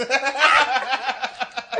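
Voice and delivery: loud, high burst of laughter; real belly laugh. Real, not acted. Teller finishes his prank story, real uproarious laughter. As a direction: crack up helplessly at your own punchline.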